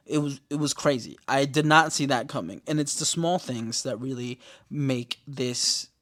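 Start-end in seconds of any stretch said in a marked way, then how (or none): none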